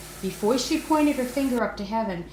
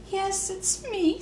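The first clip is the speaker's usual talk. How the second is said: high, slow, small tearful reply; a little voice answering through tears; small sobbing voice; 12 semitones higher, slower than usual. A narrator's reading. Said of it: "sobbed a little voice".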